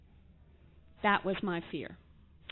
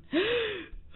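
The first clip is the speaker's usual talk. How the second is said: gasp; 12 semitones higher, 4 dB quieter than usual. Retold or imitated. Reacts to sponsor question.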